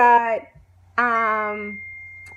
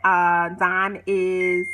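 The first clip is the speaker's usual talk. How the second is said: ordinary talk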